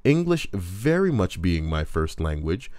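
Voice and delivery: normal talking voice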